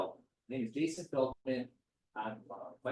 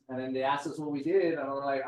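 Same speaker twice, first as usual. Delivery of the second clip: ordinary delivery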